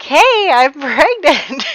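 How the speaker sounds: slow, laughing announcement; giggling through the news. Retold or imitated. Mistress telling him she's pregnant, laughing. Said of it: "El Rosaria is like".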